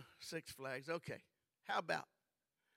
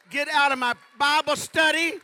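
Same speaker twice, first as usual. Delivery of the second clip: much higher and louder, shouting each command; preaching at full force; 10 semitones higher, 22 dB louder than usual. Acted. Preacher rallying the congregation against the devil, shouting. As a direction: drive the devil out, ringing from the pulpit.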